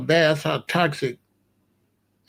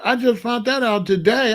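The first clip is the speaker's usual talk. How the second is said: ordinary delivery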